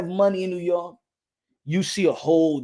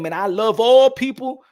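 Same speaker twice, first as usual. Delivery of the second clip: loud, higher, emphatic proclamation; proud declaration; 7 semitones higher, 10 dB louder than usual. Acted. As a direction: declare it big, leaning on ALL. Acting out proud declaration of love.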